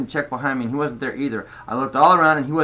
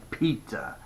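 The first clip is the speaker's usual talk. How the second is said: soft, lower, resigned "pizza"; reluctant giving in; 4 semitones lower, 8 dB quieter than usual. A narrator's reading. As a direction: concede it quietly, pitch dropped. Girl gives in reluctantly.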